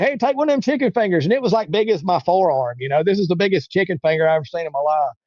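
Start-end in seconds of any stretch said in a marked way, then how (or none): 0.0-1.2 high-pitched mimicry of a friendly stranger; eager offer. Homeless man offering chicken to a stranger, eagerly imitated. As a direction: press food on him, pitched right up.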